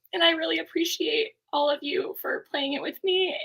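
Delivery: ordinary talk, nothing marked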